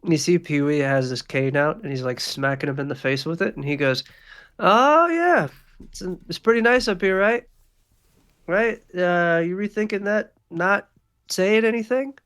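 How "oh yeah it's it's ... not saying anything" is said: high, mocking sing-song taunt; taunting crime boss. Pee-wee taunts the thug hanging off the balcony, mocking. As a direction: needle him from above, higher and louder.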